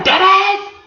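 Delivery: delighted disbelief at a bargain; incredulous. Shown as a demonstration. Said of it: "You like".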